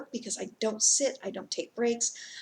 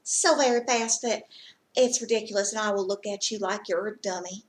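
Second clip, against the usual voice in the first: Southern US twang; 3 semitones higher, 4 dB louder than usual. Shown as a demonstration. Vlogger mocking the accent that loses them, Southern twang. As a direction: lay on a mock Southern twang. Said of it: "you take that twang".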